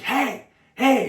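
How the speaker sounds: high, loud sharp "hey, hey" like a protective sister; big sister yelling. Retold or imitated. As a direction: call the bully off, high and loud. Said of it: "she's like".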